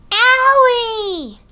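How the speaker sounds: high, loud wincing owie; sympathetic owie. Acted. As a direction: wince for her pain. Sympathetic wince.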